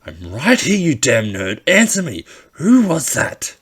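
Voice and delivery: furious bully shouting, high and loud; angry yelling. Acted. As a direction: scream it at him like an enraged bully. Katsuki yelling at silent Izuku.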